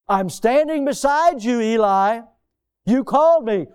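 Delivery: speaking to the hard of hearing. Acted. Samuel to hard-of-hearing Eli, speaking up. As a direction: speak up for an old man's ears. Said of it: "he's hard of hearing".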